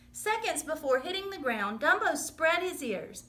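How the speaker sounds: ordinary delivery, nothing marked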